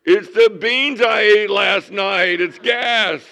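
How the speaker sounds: loud, high, slow realization; mock relief. Imagined worrier realizing the pain is just gas, mock relief. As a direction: deflate the panic with a loud discovery. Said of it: "our minds run wild".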